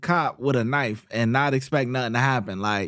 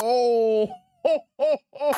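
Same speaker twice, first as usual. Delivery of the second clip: laughing; 6 semitones higher than usual. Real, not acted. Laughing at shooting clip.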